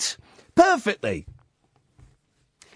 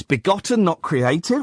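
puzzled question; 7 semitones lower than usual. Shown as a demonstration.

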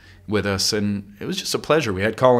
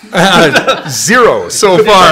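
laughing; 9 semitones higher, 13 dB louder, much slower than usual. Real, not acted. Laughs at money question.

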